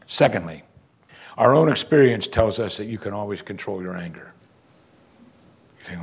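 ordinary delivery

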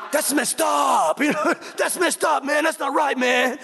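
friend's exaggerated complaint, hammed-up; imitating Pancho. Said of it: "that's how he talks".

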